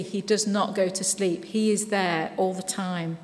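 ordinary talk

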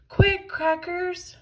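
high, loud, slow urgent call; urgent cry. A narrator's reading. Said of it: "cried Harold".

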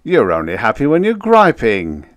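loud, jovial teasing; good-natured. A narrator's reading. Joker teasing outpost man about griping, good-natured. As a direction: rib him loudly without malice. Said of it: "Town said good-naturedly".

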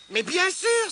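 high, loud realisation; excited cry. A narrator's reading. Tirondin grasps the riddle, excited cry. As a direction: exclaim it as it suddenly clicks. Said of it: "s'écria Tirondin".

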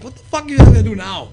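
loud disbelieving shout; incredulous yell. Retold or imitated. Retelling his reaction to wrestlers.

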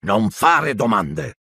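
slow, deliberate rebuke; uncle's curt rebuke; snapped retort. A narrator's reading. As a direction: cut him off firmly. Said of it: "lo rimbeccò lo zio".

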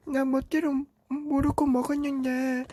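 put-on high little-kid voice tattling to dad; little brother voice. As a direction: whine it like a small child telling on his brother. Younger Teletubby explains.

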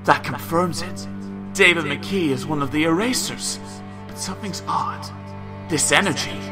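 hushed suspicious pondering; musing to himself. Acted. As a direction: think aloud, quietly piecing it together. Character's inner thoughts.

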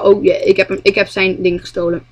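usual speaking voice

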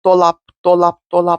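"dolap" over and over on one even note; monotone, evenly spaced repeats of "dolap"; meaningless word repetition. Shown as a demonstration. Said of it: "sürekli sanki şey diyorum böyle".

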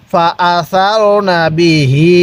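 "ফা আসারনা বিহি" drawn out very slowly, each vowel stretched; slow recitation demo. Shown as a demonstration. Teacher reciting a Quran phrase for students to repeat, very slow. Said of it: "পড়ুন".

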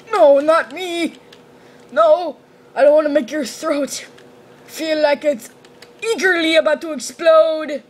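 half-hearted silly character voice; lightly voiced character. Gamer playing a character, mildly put-on voice. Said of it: "I'm gonna stop being that character".

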